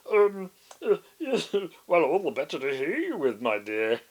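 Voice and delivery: wolf faking a high, loud granny voice, hesitant; disguised voice. A narrator's reading. Wolf pretending to be grandma, clumsy disguised voice. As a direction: clear throat and fake an old lady badly.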